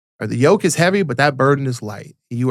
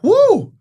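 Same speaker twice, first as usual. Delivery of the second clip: high, loud winded "woo"; exhausted whoop; 6 dB louder than usual. Retold or imitated. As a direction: blow out a big tired woo. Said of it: "I'm like".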